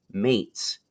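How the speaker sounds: usual speaking voice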